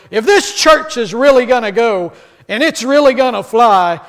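loud, high preacher build-up; preacher pushing the congregation, loud; greater gusto. Retold or imitated. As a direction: preach it big to the crowd. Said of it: "stated with even greater gusto".

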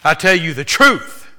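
"I tell you the truth" slow and loud, "tell" hit hard; emphatic quote. Acted. Preacher quoting Jesus, emphatic. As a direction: lean on every word. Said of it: "he's emphasized".

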